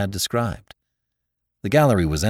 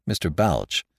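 ordinary delivery

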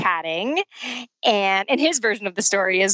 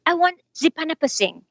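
high, animated mock French accent; playful, pitched-up imitation of a Frenchman; broken English, French accent; 9 semitones higher than usual. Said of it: "kind of in his broken f-, English".

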